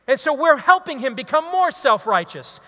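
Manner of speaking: ordinary talk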